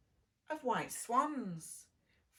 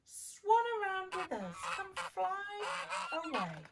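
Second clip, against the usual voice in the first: welcoming swans; 8 semitones higher than usual. Acted. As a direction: float the words like gliding swans. Swans inviting the duckling.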